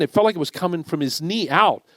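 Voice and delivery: normal talking voice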